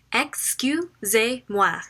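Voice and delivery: Excusez-moi drawn out at half speed; slow demo. Shown as a demonstration. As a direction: say Excusez-moi slowly, syllable by syllable. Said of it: "Excusez-moi demo".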